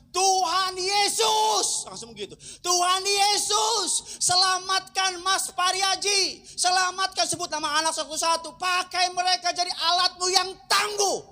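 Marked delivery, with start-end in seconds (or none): 0.1-1.8 high, drawn-out prayer shout; shouted cry. Retold or imitated. Woman suddenly crying out to Jesus as they pray over her, drawn-out shout. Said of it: "dan langsung teriak". 2.7-11.2 high, slow, full-force pleading shout; shouted prayer. Retold or imitated. Woman praying aloud for others to be saved, high sustained shout. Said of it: "dan langsung teriak".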